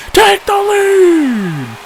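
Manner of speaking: drawn-out, high shout of joy; excited call. Real, not acted. Streamer cheering his hit, excited drawn-out shout. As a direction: stretch the call out, rising to a yell.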